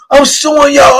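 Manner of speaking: mock holler. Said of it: "He started hollering, screaming".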